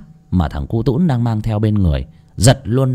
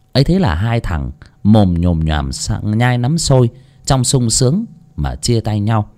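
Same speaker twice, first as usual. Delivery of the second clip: usual speaking voice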